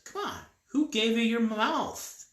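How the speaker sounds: high, loud, drawn-out challenge; acted exasperation from an indignant God; exasperated rebuke. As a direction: demand it, pitch and volume jumping up. God rebukes reluctant Moses.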